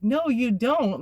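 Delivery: lightly voiced patient boyfriend. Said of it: "he's like".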